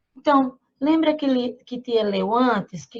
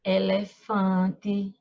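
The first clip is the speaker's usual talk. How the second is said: slow syllable demonstration; 4 semitones lower, much slower than usual. Shown as a demonstration. Teacher shows word slowly.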